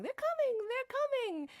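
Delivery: high-pitched mock scream; imitated movie-trailer scream, high and urgent; Tippi Hedren screaming. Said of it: "Tippi Hedren herself screaming".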